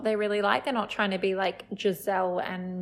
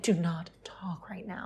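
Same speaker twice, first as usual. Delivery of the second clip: imitated hushed order; very soft, low, precious whisper; mock whisper; 4 semitones lower, 7 dB quieter than usual. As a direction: whisper it like a singer saving the voice. Mimicking singer saving her voice.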